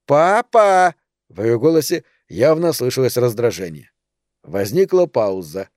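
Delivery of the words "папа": loud impatient yell for dad; girl bawling for her father. Acted. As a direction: shout across the house, annoyed. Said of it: "бросила трубку на стол и заорала".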